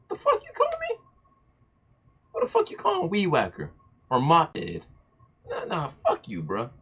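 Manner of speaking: high, hammed-up furious squeal; mock outrage. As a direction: pretend to be fighting mad over the name. Imagined confrontation over nickname.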